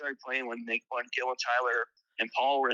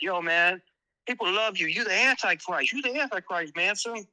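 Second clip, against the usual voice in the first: [name] hyping; 6 semitones higher than usual. Retold or imitated. [name] hyping up [name] in the background, higher excited voice. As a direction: hype him up like an excited fan. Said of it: "And it was fucking [name]".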